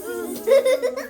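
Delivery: high, loud buzzing zzz with a laugh; cheeky monkeys buzzing back; silly loud high zzz, giggling; monkey chatter. Acted.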